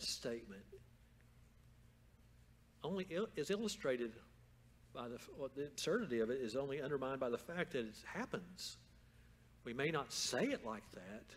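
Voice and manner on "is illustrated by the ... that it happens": ordinary talk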